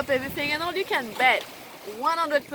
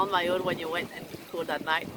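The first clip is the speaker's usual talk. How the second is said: usual speaking voice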